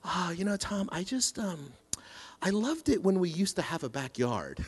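softly and patiently imitating his wife; a gentle, very patient hint. Husband quotes his patient wife's gentle hint, soft and patient. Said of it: "just very patiently says".

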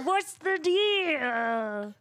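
high, extremely drawn-out parody voice; Bill Cosby doing Bobcat Goldthwait. Acted. Topping off the stacked impression, Cosby as Goldthwait. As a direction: drag the words out high and silly. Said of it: "Bill Cosby doing Bobcat Goldthwait".

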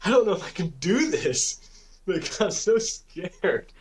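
high-pitched retold near-tears plea; upset, scared. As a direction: imitate yourself panicking, voice jumped high. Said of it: "I was so upset. I was like".

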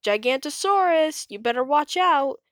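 high, loud warning shout. A narrator's reading. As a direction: call out the danger to everyone. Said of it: "they heard a shout".

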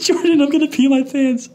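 high squeal, mimicking someone about to burst; imitating helpless laughter. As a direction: play the brother too tickled to talk. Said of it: "all I hear is him laughing".

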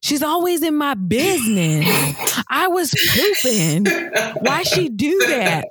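put-on put-upon child griping; indignant son. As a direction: play the son complaining about his nosy teacher. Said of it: "This boy fixed his face to say".

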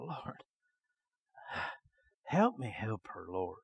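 soft murmured plea to the Lord; quietly asking God for help; hushed prayer. Retold or imitated. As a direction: pray it under your breath. Quiet prayer in retold healing.

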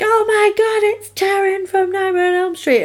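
high, excited fangirl gasp; excited squeal. Retold or imitated. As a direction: squeal it like spotting a star. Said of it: "I got really excited".

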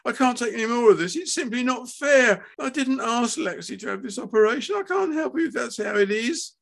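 high, distressed wail; anguished, near tears. A narrator's reading. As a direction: protest as if about to cry. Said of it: "wringing her hands in anguish".

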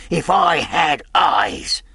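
slow, high anguished shout; drawn-out bitter yell; frustrated cry. A narrator's reading. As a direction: wail it in helpless rage. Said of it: "he cried".

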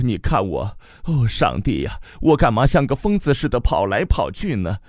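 更高的慈母声, 激动; 又哭又笑地念叨着; 絮叨的母亲. Acted. 母亲慌乱地自我辩解. (激动的母亲絮叨)

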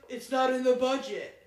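a loud, blunt no from husband; loud flat refusal. Retold or imitated. Husband refusing a trip, loud and blunt. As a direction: shut it down loudly and firmly. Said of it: "He goes".

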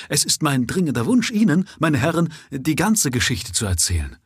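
urgent, pressing the words out eagerly. A narrator's reading. Prisoner begging the gentlemen to hear his story, eager. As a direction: lean in, keen to tell it all. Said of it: "versetzte der Gefangene eifrig".

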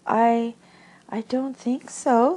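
high, slow, halting answer; uneasy hesitation. A narrator's reading. Piglet uneasily answers awkward question.

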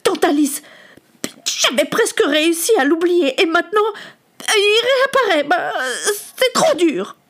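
distraught, high-pitched near wail; anguished boy's outburst; resurfacing fury. Acted. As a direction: protest as if about to cry. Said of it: "La fureur que Samuel ... refaisait dangereusement surface".

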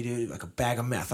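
normal talking voice

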